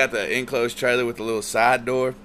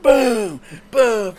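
loud booming thuds, pitched high and much louder; imitated banging; 11 semitones higher, 11 dB louder than usual. Drunk buddy pounding inside the locked trailer, loud booms. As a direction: slam out each boom like fists on metal.

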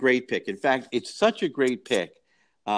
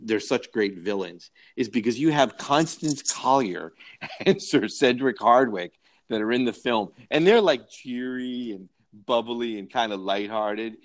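ordinary talk

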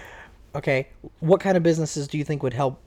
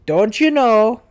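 higher, louder put-on Canadian accent; 5 semitones higher, 6 dB louder than usual. Shown as a demonstration. Kid asks for Canada, hammed-up Canadian accent. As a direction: ham up a friendly Canadian accent. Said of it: "Then do Canada".

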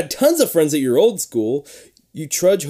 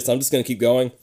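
usual speaking voice

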